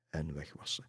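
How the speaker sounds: ordinary delivery, nothing marked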